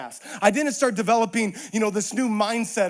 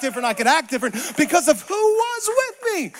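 louder, higher, rising preaching; preaching build; 5 semitones higher, 7 dB louder than usual. Acted. Preacher telling the congregation who walks with him, rising to a triumphant shout.

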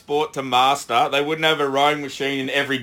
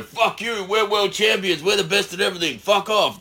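brash American attitude; 5 semitones higher than usual. Retold or imitated. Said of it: "America just go".

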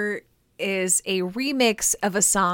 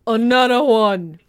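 louder hype-man call-out; [name] catchphrase; 2 semitones higher, 7 dB louder than usual. Retold or imitated. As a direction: shout it like a DJ tag. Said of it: "D J Khaled".

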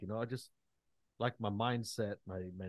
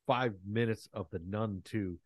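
ordinary talk, nothing marked

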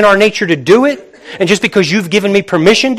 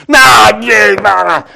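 put-on loud, whiny kid's "Nah"; whining kid refusal; 4 dB louder, slower than usual. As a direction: mimic a kid shouting back at a parent. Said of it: "I see a lot of kids doing this".

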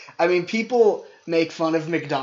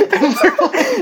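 laughing; 7 dB louder than usual. Real, not acted. High laughing lead-in.

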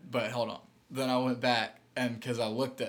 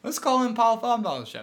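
excited setup; 9 semitones higher, 6 dB louder than usual. Pretend. Launching the caller bit.

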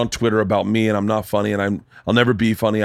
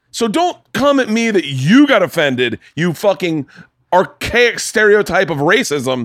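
slow, louder, higher heated rant; angry rant; 6 semitones higher, 3 dB louder, slower than usual. Real, not acted. Comic to podcast co-host, slow heated rant. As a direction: lay into him, word by word.

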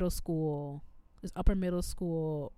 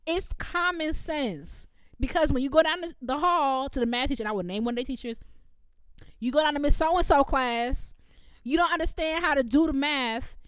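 higher, louder exasperated lecture; irritated rant; 5 semitones higher, 5 dB louder than usual. Retold or imitated. Teacher lecturing students about practicing, higher and louder. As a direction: lay out the common sense, fed up. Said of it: "Because I was so irritated".

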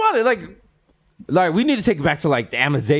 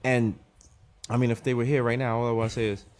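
low, soft, halting funeral speech; put-on grave memorial tone; mock solemn eulogy; 5 semitones lower, 6 dB quieter than usual. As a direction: deliver a hushed tribute with long pauses. Mock eulogy for the two hosts.

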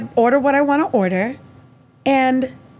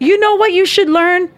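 scolding; 6 semitones higher, 4 dB louder than usual. Real, not acted. Scolding the date.

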